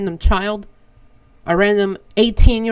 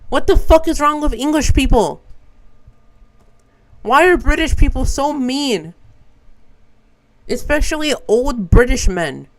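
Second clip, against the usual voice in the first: higher-pitched, indignant venting; exasperated rant; 4 semitones higher than usual. Real, not acted. Traveler complains about rude old British men, exasperated. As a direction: demand an answer, pitch raised in exasperation.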